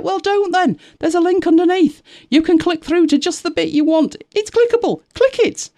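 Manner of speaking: very high, loud mock-exasperated squeal; exasperated retort. Creator answering a viewer who won't click the link, exasperated.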